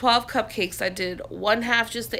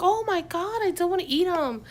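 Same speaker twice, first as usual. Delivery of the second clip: excited coworkers; 10 semitones higher than usual. Retold or imitated. Coworkers admiring the cupcakes.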